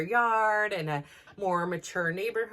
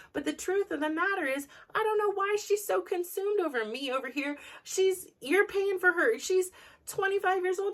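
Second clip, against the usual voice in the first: agitated venting; 7 semitones higher than usual. Real, not acted. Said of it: "I could tell by my tone".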